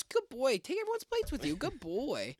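high sing-song then trailing soft; mock patronizing praise. Brother praising him at Justin's family dinner, sing-song. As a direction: talk down to him like a pet.

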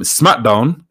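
normal talking voice

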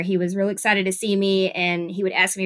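ordinary talk

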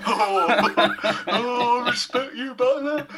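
high-pitched sitcom inspector impression; hammed-up comic moan in a high voice; Blakey. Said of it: "Sound like Blakey from On the Buses".

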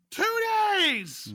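high, loud drawn-out shout; outraged yell. Acted. Co-host ranting at a reckless film heroine, yelling. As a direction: yell it in mock-scandalized disbelief.

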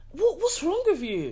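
high-pitched, incredulous scolding; indignant scolding. Acted. Indignant address to the gossiping character.

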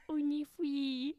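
put-on high, slow baby talk. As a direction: coo it like a little kid. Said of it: "She'd talk like this certain baby voice".

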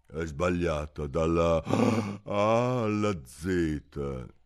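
very slow, weighty verdict; firm. Acted. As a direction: pronounce it with finality. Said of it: "disse il bruco con fermezza". (con fermezza, lento e deciso)

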